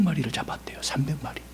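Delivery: secretive storyteller whisper. A narrator's reading. Teacher telling children about the foxes, hushed whisper. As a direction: hush it like a secret.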